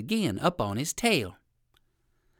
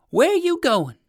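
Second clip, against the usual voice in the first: high crow voice; 13 semitones higher, 7 dB louder, slower than usual. Acted. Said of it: "asked the crow of Uncle Wiggily".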